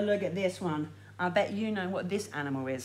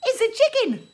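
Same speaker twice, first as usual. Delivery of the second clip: high, loud delighted reveal; thrilled chicken reveal; excited reveal; 12 semitones higher, 11 dB louder than usual. Acted. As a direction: announce it with big excitement.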